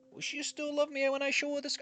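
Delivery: high, plaintive lovesick voice; mock pining. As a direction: voice the lovelorn worry in a high, put-on voice. Voicing the character's lovelorn worry.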